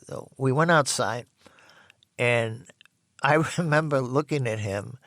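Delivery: ordinary talk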